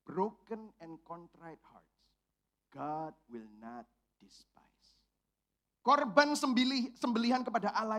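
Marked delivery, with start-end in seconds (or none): 0.1-5.0 slow, soft, fading into a whisper; slow whispered holy verse; hushed scripture. Acted. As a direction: breathe the verse reverently, slowly. Quoting scripture tenderly.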